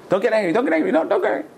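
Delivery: head off the rabbi's anger with urgent, high repeats; anxious plea. Acted. Servant pleads before confessing a loss.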